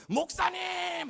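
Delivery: high, loud, long-held holler; long-distance call. Retold or imitated. Big schoolgirl to the pastor from the hill, drawn-out shout. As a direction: imitate a girl yelling from the hilltop. Girl calls from hilltop.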